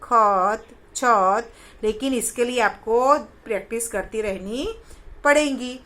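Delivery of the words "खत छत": word reading demo. Shown as a demonstration. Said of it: "जैसे कि मत".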